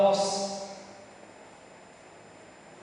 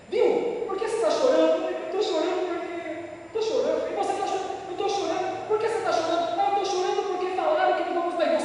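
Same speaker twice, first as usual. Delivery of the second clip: acted dialogue; 8 semitones higher than usual. Acted crying Israelites dialogue.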